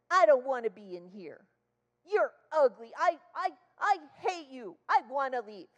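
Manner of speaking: loud, high mock kid's outburst; imitated child tantrum. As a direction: throw a little girl's tantrum.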